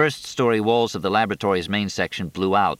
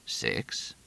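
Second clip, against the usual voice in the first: softly muttered question; to himself; 8 semitones lower, 11 dB quieter than usual. A narrator's reading. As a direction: ask yourself under breath. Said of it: "To himself".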